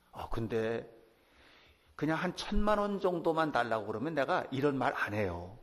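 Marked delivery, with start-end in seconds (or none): none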